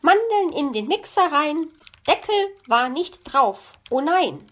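high, rhythmic magpie recitation; reciting a couplet. A narrator's reading. Magpie recites her kitchen couplet to fox, reciting. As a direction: recite the rhyme brightly pitched, with playful alarm. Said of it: "rezitieren Sie Ihren Zweizeiler".

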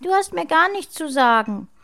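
put-on high, loud, defiant child; provocative little sister. A narrator's reading. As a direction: snap back at a big brother. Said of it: "Provozierend sah Lena".

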